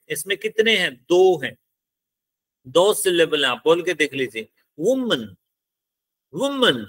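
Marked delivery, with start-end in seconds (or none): 4.8-6.8 woman with वू stressed, the pitch rising then falling; stress on first syllable. Shown as a demonstration. Teacher shows students where stress falls in woman, stressing वू. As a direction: say woman slowly as a demo, leaning on वू. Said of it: "इसमें stress किस पे लग रहा है? वू पे".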